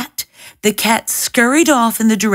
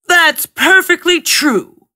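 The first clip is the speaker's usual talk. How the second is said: slow, louder ogre boast; 8 semitones higher, 3 dB louder than usual. A narrator's reading. Ogre boasts to the cat, slow and booming. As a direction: boom it out slowly like an ogre. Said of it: "said the ogre".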